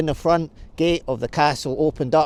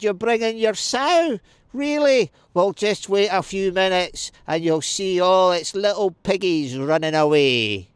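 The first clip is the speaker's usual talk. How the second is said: high, mocking taunt full of scorn; scoffing; 8 semitones higher, 4 dB louder than usual. A narrator's reading. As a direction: scoff down at the besieger from the walls. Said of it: "she scoffed at him".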